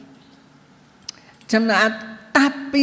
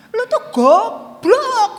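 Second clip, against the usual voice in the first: mock coarse Surabaya insult, high and loud; husband's rude insult; 8 semitones higher, 6 dB louder, much slower than usual. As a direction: bark it like a blunt Surabaya man. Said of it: "Bapak Gembala gemes sama saya digini". (hinaan kasar gaya Surabaya)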